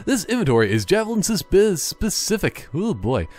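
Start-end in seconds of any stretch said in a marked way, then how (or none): none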